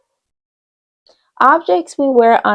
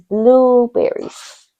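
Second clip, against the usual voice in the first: blueberries said very slowly, each syllable drawn out; teacher models the word again for the class; 4 semitones higher, much slower than usual. Shown as a demonstration. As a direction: stretch blueberries out for young learners. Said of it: "Can you say blueberries?".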